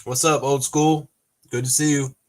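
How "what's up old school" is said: ordinary delivery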